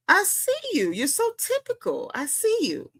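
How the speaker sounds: knowing, high-pitched tease; teasing taunt. Real, not acted. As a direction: taunt them in a high, light voice. Taunting Pinky directly.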